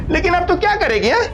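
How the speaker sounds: high, taunting gloat; mock her helplessness; gloating villain. Villain gloats after cutting line.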